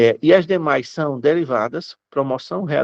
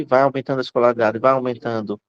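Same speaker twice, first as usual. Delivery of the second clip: usual speaking voice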